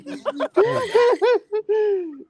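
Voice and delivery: long high-pitched laugh; real laughter. Real, not acted. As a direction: burst out laughing at a friend. Laughing at a friend's remark.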